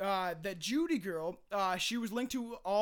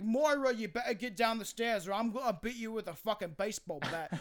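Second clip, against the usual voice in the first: hammed-up British threat; British accent. Podcaster playing someone threatening Myra, mock British accent. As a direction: call out the name, then scold in mock British. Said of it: "That is very British".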